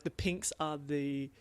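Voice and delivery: usual speaking voice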